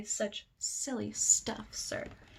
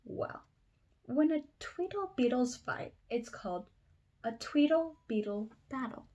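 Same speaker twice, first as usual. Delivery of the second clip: ordinary delivery, nothing marked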